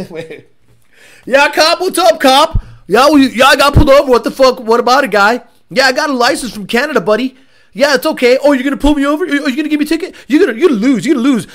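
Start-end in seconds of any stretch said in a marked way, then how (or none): 1.2-11.5 higher, louder, hammed-up complaining; mock belligerent speeder. Mocking a pulled-over driver to a cop, belligerent. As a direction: play an obnoxious driver taunting the police.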